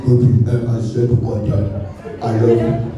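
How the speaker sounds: deep mock romantic sweet talk; lover boy. Boyfriend sweet-talking his girlfriend.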